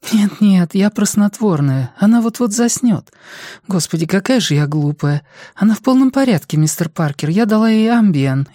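high, hurried voice of a worried nurse; a nurse rushing to correct herself, pitched high; flustered nurse. Acted. Nurse reassures visitor about his sleepy mother, flustered.

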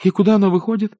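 put-on high-pitched boy's question; demanding youngster. A narrator's reading. Boy asks the wizard about the door, demanding. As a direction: ask in a high, eager boy voice.

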